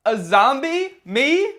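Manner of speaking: loud, high, rising disbelief; mock incredulity. Zombie denying to survivor what he is, mock incredulity. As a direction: act shocked at an absurd accusation. Zombie feigns shock at accusation.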